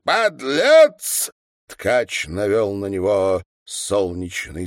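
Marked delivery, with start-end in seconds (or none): 0.0-1.3 high, loud, drawn-out yell; full-force cry of abuse; shout louder than all. A narrator's reading. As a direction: outshout the whole crowd. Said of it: "закричал громче всех".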